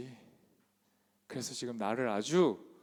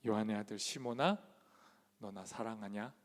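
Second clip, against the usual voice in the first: Jesus asking softly; 6 semitones lower, 8 dB quieter than usual. Acted. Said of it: "뭐라 그래요".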